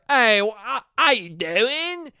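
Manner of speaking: drawn-out, high, bad Australian greeting; hammed-up Australian dude impression; mock Australian accent. Australian dude greeting a stranger, mock Australian accent. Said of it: "Australian dudes who are like".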